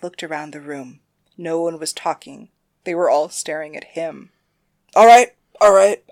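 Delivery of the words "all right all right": defensive backpedal. Acted. Caught out, backpedalling.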